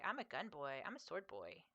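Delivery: small, soft imitation of a good boy; Griffin. Streamer imitating the podcast's baby brother, soft and cutesy. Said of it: "he talks like this".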